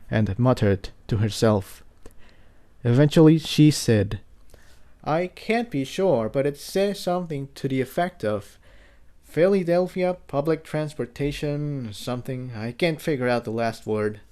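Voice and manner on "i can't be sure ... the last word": lightly voiced young pony, curious and gentle; Tiptoe. Tiptoe reading the sign.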